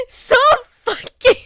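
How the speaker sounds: slow words squeezed out through high laughter; laughing while talking. Real, not acted. Still giggling about the cursed spider, laughing through words. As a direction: try to speak but the laugh keeps winning.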